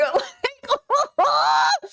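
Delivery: squealing high-pitched laugh. Real, not acted. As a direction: burst into a high helpless laugh. Reacting to song lyrics.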